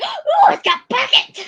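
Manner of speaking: high, loud delighted squeal with laughter; excited squeal. Retold or imitated. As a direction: gasp then squeal with joy. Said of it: "that was my reaction".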